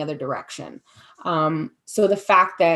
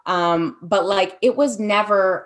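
ordinary talk, nothing marked

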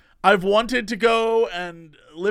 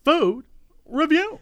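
reviewer imitation; 8 semitones higher, slower than usual.

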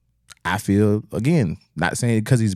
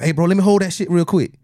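put-on higher-pitched young man showing off; hyped live-streamer; 6 semitones higher, slower than usual. Young player to his friends on a livestream, hyped showing off. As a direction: play a kid flexing on a livestream. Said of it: "You go live".

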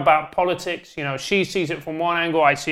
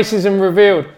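louder mock reveal; 2 semitones higher, 7 dB louder than usual. Real, not acted.

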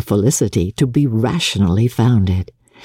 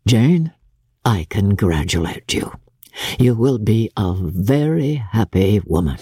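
put-on lower, fond old father; a lightly voiced gruff father; Mr Bennet, dry; 5 semitones lower than usual. Mr Bennet to his daughter.

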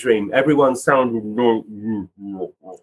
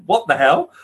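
disbelief; 7 semitones higher, 3 dB louder than usual. Retold or imitated. Disbelief at spirit claim.

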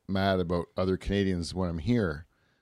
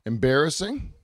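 higher pitch, rising at the end; questioning echo; 6 semitones higher than usual. Real, not acted. Guest queried about behaviour in Jamaica, questioning echo. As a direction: throw the word back as a question. Echoes the question put to him.

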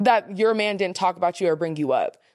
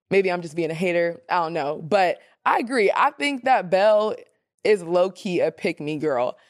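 ordinary talk

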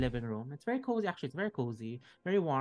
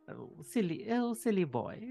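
playful grandmotherly tease, a little higher; teasing old lady; 5 semitones higher than usual. Acted. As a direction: tease the boy fondly. Old woman teases young man.

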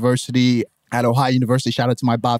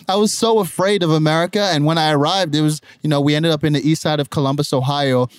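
ordinary talk, nothing marked

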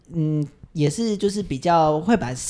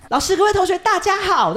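much higher, louder, booming opener; super loud greeting; 13 semitones higher, 3 dB louder than usual. Retold or imitated. Student opens the presentation to teacher and class, booming. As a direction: blast the hello to the whole class. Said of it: "我就声音超大声".

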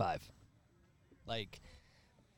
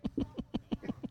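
snorting laugh; contemptuous snort; 11 dB quieter than usual. Real, not acted. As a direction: snort of laughter through nose. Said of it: "Did you just snort?".

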